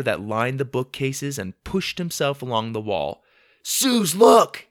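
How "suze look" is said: high, loud call to a sister; loud boyish holler; excited shout. A narrator's reading. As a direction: shout for her to look. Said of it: "he shouted".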